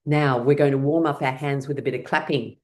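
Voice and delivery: ordinary delivery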